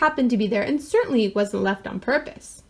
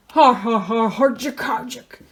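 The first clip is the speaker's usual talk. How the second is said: mock villain cackle, drawn out; hammed-up evil dinosaur laugh; big evil laugh; slower than usual. A narrator's reading. Norwegian dinosaur chuckling at his family's plan, evil laugh. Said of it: "sounded like a big evil laugh".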